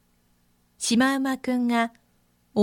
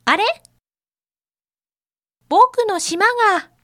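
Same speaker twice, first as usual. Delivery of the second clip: put-on shocked young zebra; 9 semitones higher, 7 dB louder than usual. A narrator's reading. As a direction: cry out in disbelief. Zebra sees stripes gone.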